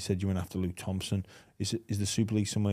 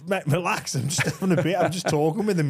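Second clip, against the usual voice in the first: mock innocent reply; 8 semitones higher, 6 dB louder than usual. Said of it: "I'm like".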